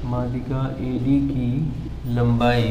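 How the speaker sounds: slow, soft and low, word by word; slow writing-along voice. Real, not acted. Maths teacher writing out the median's length, slow, low writing-along voice. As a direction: say it quietly to yourself while writing.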